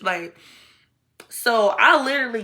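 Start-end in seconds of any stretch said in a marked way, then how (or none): none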